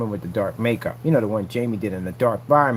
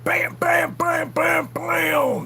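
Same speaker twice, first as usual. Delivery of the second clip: rapid-fire high-pitched "bam" sound effects; high shouted bams; much slower than usual. Acted. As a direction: punch out each bam high, like blasts. Imitating the glaring light hitting.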